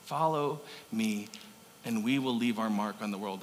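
hushed call to follow; gentle Jesus, almost murmured; tender invitation. Retold or imitated. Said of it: "Jesus leans in and says".

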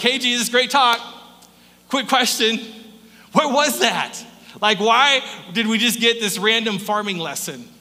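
mock-confused followers questioning Jesus; puzzled disciples. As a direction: play the baffled disciples asking.

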